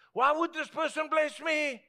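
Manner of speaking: high-pitched, incredulous voice; baffled questioning. Retold or imitated. Preacher voices a person puzzled by a blessing, baffled. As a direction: act out someone bewildered at being blessed.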